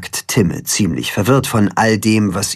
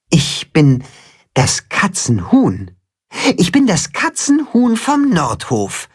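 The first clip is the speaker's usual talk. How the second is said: rising, higher proud declaration; 8 semitones higher than usual. A narrator's reading. Chicken proudly telling the cat who he is. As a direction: announce your name with pride. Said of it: "sagt Timme stolz".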